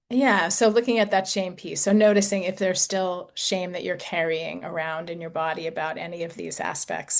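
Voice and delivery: ordinary talk, nothing marked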